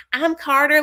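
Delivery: high-pitched imitation of a party guest; mock party girl. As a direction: chirp the introduction in imitation. Voices a party guest introducing herself.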